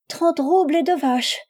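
high, piping, plaintive offer; little flute voice. A narrator's reading. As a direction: sigh it out in a fluty little voice. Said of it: "soupira Pélagie en petite flûte".

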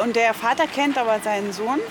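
ordinary talk, nothing marked

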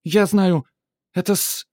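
put-on high squealing girl; cartoon princess. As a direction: squeal like a bratty little girl. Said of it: "верещит принцесса". (капризная принцесса визжит)

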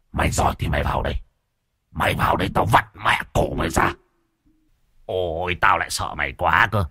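rough, growled threats; menacing ghost voice. A narrator's reading. Possessed man dares his challenger to come in, menacing growl. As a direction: taunt back in a rasping possessed voice. Ghost taunts back from the room.

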